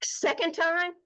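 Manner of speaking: high, stunned question; shocked disbelief. Retold or imitated. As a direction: repeat the news in disbelief. Shocked by the cancer's return.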